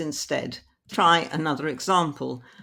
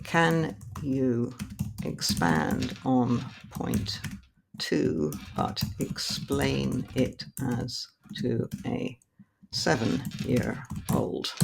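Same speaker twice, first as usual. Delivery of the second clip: slow dictation; much slower than usual. Shown as a demonstration. Reading a typed prompt aloud.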